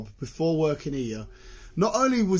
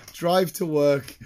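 ordinary talk, nothing marked

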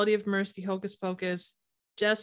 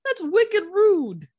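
put-on slow, much higher and louder Boston accent; mock Boston accent; 10 semitones higher, 5 dB louder, much slower than usual. Teasing a co-host in a slow, hammed-up Boston accent. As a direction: drag out a jokey Boston accent, wicked rude. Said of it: "now you just sound like me when I try to do".

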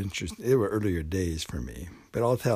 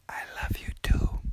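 whispered. Pretend. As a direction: murmur it back secretly. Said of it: "the third most".

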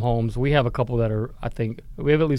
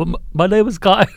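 higher, loud, badly faked British accent; 4 semitones higher, 5 dB louder, faster than usual. Shown as a demonstration. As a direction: reluctantly attempt a clumsy British accent. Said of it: "I can't do a British accent".

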